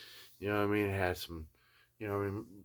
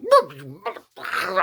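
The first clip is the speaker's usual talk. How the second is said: sharp, high, loud outburst; outraged; 8 semitones higher, 7 dB louder than usual. Retold or imitated. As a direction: explode in disbelief. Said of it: "I was like".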